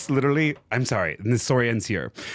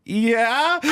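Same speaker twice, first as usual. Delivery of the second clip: much higher rising yeah; imitated student reply; 10 semitones higher than usual. Student answering teacher's football question, high imitation. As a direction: lift it high like a bemused kid.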